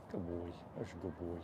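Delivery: soft, murmured praise; calm the horse with quiet, tender praise; soothing praise. Real, not acted.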